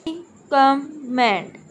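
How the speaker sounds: slow recommend, split into three beats; syllable by syllable. Shown as a demonstration. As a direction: say recommend slowly, each syllable separate. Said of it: "तीन syllable आ रहे हैं". (Recommend को तीन syllable में धीरे-धीरे तोड़कर)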